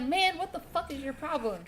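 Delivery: high-pitched, indignant re-enactment, not shouted; retold angry challenge. Storyteller turning on the man who shoved him, high indignant challenge. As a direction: act out losing your patience.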